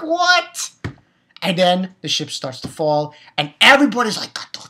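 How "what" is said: much higher, startled What; stunned viewer reacting to the twist; shocked. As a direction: squeak it in disbelief. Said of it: "I'm like".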